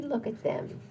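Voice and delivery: usual speaking voice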